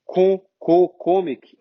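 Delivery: comic as "KOH-mik", with Portuguese O; wrong closed O. Shown as a demonstration. Teacher showing the mistake, comic with closed O. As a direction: round the first vowel of comic into "oh". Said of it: "não é".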